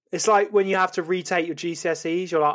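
normal talking voice

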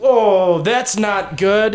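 high, loud, drawn-out mock alarm; alarmed reaction. Game master voices the wounded grunt, alarmed reaction. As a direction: stretch out the dismay, high-pitched.

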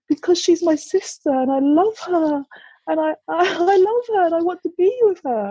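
high, plaintive little-girl imitation; blurted child's confession. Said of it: "she just kind of blurts out".

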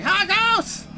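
high-pitched name yell. Acted. Flinging the door open, still yelling for Harkos. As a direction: keep calling out at a high pitch. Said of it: "still yelling".

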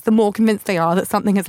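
ordinary delivery

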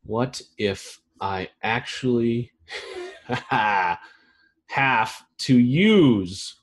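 very slow, broken word by word; dictating while writing. Real, not acted. Host writing down a meme line, slow dictating. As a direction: say it in pieces as you write, long pauses between. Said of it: "I'm writing it down".

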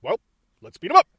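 quick, loud quoted catchphrase; imitated boss's punchy one-liner; hammed-up movie villain. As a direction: rattle off the order with a loud upward jump. Mimicking Yoshida's face and line.

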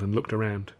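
ordinary delivery

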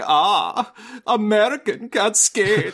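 hammed-up mock German accent, pitched high and drawn out; generic pseudo-German accent. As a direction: do a bad, slow movie-villain German accent. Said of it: "pseudo-German. They're like".

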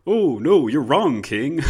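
high, loud mock rebuke; cheeky subject. Subject mockingly telling the king off, loud. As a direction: scold the king in a jokey squeal. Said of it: "as if now someone would be like".

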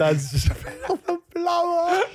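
laughing too hard to finish the mock line; laughing mock Cockney. Husband mocks the partner's Cockney line while laughing. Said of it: "I don't say".

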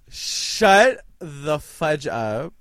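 slow, loud disbelief; shocked. Real, not acted. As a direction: drag it out in shock. Shocked reaction.

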